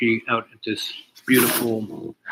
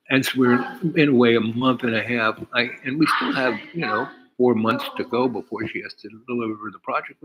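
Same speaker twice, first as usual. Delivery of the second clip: normal talking voice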